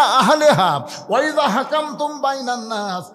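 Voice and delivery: high, loud proclamation and recitation; full-force preaching. Real, not acted. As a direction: declaim it at the top of your voice. (উঁচু গলায় জোরালো ঘোষণা ও তিলাওয়াত)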